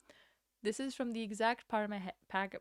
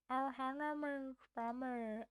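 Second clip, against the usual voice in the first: slightly higher, croaky alien voice; Stitch impression; 3 semitones higher than usual. Shown as a demonstration. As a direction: rasp it like Stitch. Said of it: "I can't get the end of it right".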